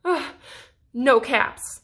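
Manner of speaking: ordinary delivery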